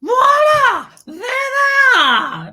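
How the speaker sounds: high, drawn-out triumphant cry; showy presentation. Shown as a demonstration. Host presenting flowers in a vase, triumphant flourish. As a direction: unveil it with a loud high flourish.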